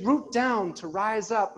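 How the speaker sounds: slow, airy mock yoga instructor; drawn-out imitation of the yoga teacher. Comic mocking the teacher's class cue, slow and lofty. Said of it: "she's saying stupid things like".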